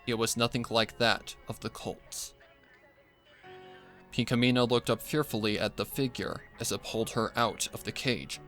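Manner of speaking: plain reading voice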